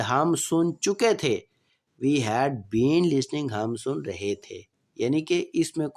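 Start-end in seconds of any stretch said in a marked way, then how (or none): 2.1-3.5 slow, clear dictation pace; slow classroom example sentence; slow example read. Shown as a demonstration. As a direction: spell it out slowly for students. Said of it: "teacher reading tense example".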